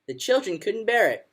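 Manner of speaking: plain reading voice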